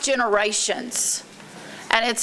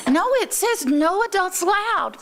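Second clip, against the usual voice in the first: put-on higher insistent little girl; lightly voiced young niece; child voice; 7 semitones higher than usual.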